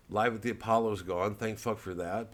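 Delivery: ordinary delivery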